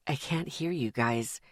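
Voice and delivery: low, soft weary mutter. Acted. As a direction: mumble it, drained. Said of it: "he muttered".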